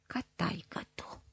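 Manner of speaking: a soft, venomous whisper; hissed through teeth. A narrator's reading. Mother hissing a jab about the father, soft venomous whisper. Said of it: "a șuierat ea printre dinți".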